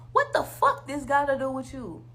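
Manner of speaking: fed-up indignant demand; exasperated outburst. Real, not acted. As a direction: snap the question at the commenters. Ranting at commenters.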